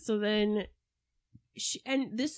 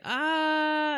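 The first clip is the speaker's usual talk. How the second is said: mock grunt; 9 semitones higher, 5 dB louder than usual. Castro grunts from rocking chair.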